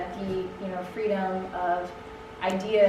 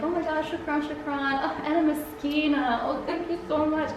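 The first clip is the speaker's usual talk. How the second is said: higher, mock-gushing gratitude; lightly hammed-up thankful gushing; gushing thanks; 6 semitones higher, 4 dB quieter than usual. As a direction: play the grateful foreigner thanking her over and over. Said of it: "you're like".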